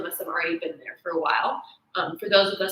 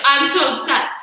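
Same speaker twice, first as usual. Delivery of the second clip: louder, whiny complaint; mock upset; 5 semitones higher, 7 dB louder than usual. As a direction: gripe like a student who lost her calm. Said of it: "And I'm like".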